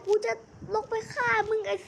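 high, put-on voice vowing revenge; high threatening character voice. Vowing to go down and kill the Skibidi Toilet, high mock threat. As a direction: threaten him in a high cartoon villain voice.

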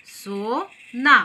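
सोना very slowly, split as सो, ना; सो... ना, joined slowly for learners; syllable by syllable. Shown as a demonstration. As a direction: sound out सोना in two separated, drawn-out syllables. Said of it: "शब्दों को हमने किस तरह से जोड़ा है".

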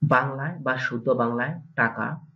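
ordinary talk, nothing marked

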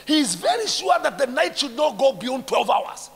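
loud high sermon build; fiery preaching. Acted. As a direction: drive the point home shouting. Preacher rising again.

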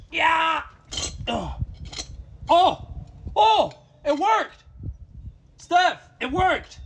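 high, loud bursts of amazement; elated shouting. Real, not acted. Streamer tells Steph the fix worked, elated shouting. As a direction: shout the good news, thrilled. Fix suddenly works, celebrating.

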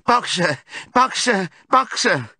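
repeated high, loud calls; desperate cry. A narrator's reading. Clover cantering after the van, desperate cry. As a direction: keep calling the name, high and urgent. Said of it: "she cried".

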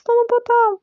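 high, drawn-out panicked wail; shouting on the run. A narrator's reading. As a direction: stretch each cry high, raising the alarm. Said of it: "кричав та кричав". (протяжний панічний крик)

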